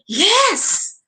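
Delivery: drawn-out, enthusiastic yes with a high swoop; eager, swooping exclamation. Shown as a demonstration. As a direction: say it slowly with lively enthusiasm. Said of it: "What about the enthusiastic yes?".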